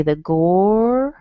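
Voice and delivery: "gor" drawn out slowly, vowel held. Shown as a demonstration. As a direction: stretch "gor" long and slow. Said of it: "this is how I might say it".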